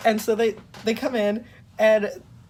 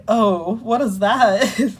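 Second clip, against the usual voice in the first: mock alarmed surprise; 2 semitones higher, 6 dB louder, much slower than usual. Patient reacts to diagnosis.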